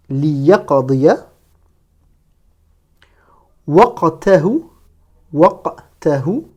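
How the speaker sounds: isolated words with long pauses; deliberate reading for learners; detached word-by-word. Shown as a demonstration. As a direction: model each Arabic word on its own. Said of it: "chaque mot de manière détachée".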